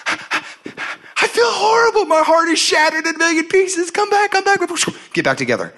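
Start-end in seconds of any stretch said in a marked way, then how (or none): 0.1-4.6 mock heartbroken wail. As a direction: overplay the broken heart, pleading. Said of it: "So we break up again".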